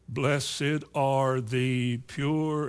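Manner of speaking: very slow, word by word; slow reading. Shown as a demonstration. Preacher showing his church how slowly to read. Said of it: "read it like that".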